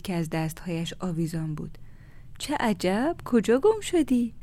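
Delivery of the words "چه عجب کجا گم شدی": higher, drawn-out friendly greeting; pleasant surprise. A narrator's reading. Neighbour Maryam meets Leila behind her in the shop, pleasantly surprised. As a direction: greet a long-unseen neighbour with lilting surprise.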